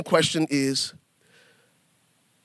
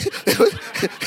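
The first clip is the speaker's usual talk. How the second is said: high breathy giggle; 14 semitones higher than usual. Retold or imitated. Laugh inside acted quote.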